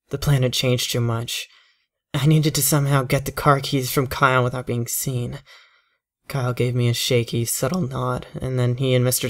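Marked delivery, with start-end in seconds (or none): none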